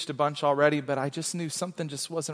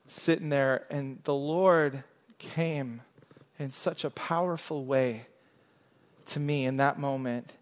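ordinary talk, nothing marked

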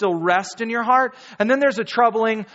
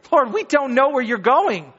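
bewildered Thomas; 5 semitones higher, 3 dB louder than usual. Retold or imitated. Pastor voicing Thomas confused on the road with Jesus, higher puzzled plea. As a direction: pitch up, puzzled and a little lost. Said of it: "my inflection, my imaginative interaction".

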